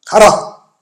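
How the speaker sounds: one loud, firm order; mock divine command, punched out. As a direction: send him off with one strong word. Voices Jesus commanding the healed man.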